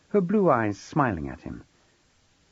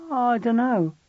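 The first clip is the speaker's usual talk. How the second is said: put-on higher boy's voice, mumbled; sulky teen boy; 12 semitones higher, 3 dB louder, slower than usual. A narrator's reading. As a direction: shrug it off like a sullen boy. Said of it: "George insisted".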